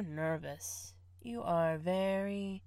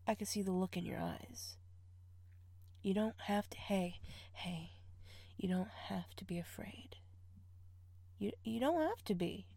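soft, quick comforting voice; reassuring; 9 dB quieter than usual. Acted. Calming the nervous partner, softly reassuring. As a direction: soothe them gently.

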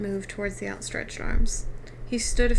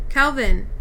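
louder, urgent call of a name; raised-voice call, falling at the end; 3 semitones higher, 7 dB louder than usual. A narrator's reading. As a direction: call out to get his attention. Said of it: "she called".

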